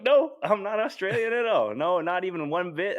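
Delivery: high, loud retold protest; emphatic denial. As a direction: deny it emphatically, pitch way up. Retelling his denial to customers.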